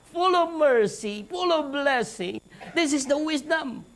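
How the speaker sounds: ordinary talk, nothing marked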